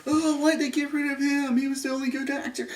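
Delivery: lightly voiced whiny fan; a hint of a complaining fan; mocking fans. Mocking fans upset he was killed, light whiny voice. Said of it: "everybody's like".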